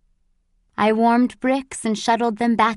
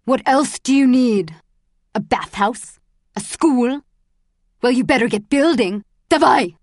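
loud, scornful guard barking questions; harsh commanding shout; angry taunting; 3 semitones higher, 7 dB louder than usual. A narrator's reading. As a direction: taunt loudly, then bark the order. Guard taunts, orders prisoner to work.

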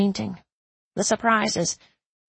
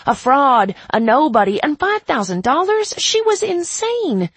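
incredulous; 8 semitones higher, 6 dB louder than usual. A narrator's reading. Narrator's disbelief, pitched far up.

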